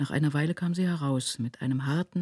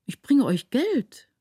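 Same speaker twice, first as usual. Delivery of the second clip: high, slow, louder, mildly taken aback; more puzzled than frightened; astonished; 10 semitones higher, 5 dB louder, slower than usual. A narrator's reading. Son answering his startled mother, astonished. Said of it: "mehr erstaunt als erschreckt".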